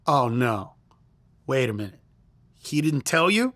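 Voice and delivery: low, hesitant dawning realization; acted-out uneasy discovery, pitched low; retold dismay. Girlfriend's dawning dismay in retold confession.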